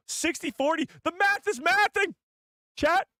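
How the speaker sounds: high-pitched, giddy yelp; excited outburst. Real, not acted. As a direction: exclaim to the audience in a high falsetto. Reacts to poll result to chat.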